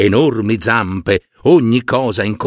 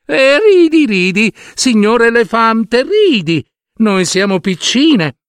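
high, defiant small voices; proud little ants; 7 semitones higher than usual. Acted. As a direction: stand up to the bully with pride. Ants answer the elephant. (formiche orgogliose che rispondono all'elefante)

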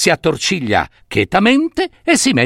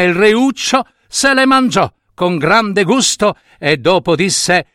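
usual speaking voice

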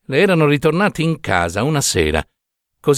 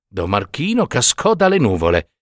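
ordinary delivery, nothing marked

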